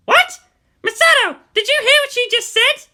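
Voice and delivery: very high, loud outraged cry; outraged shock. Acted. Asuka appeals to Misato, outraged shock. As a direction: shriek it in disbelief.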